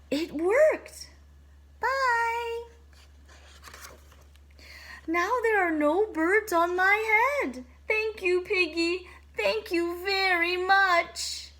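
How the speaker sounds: high, bright thankful voice; relieved delight. Acted. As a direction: gush your thanks. Elephant relieved and grateful.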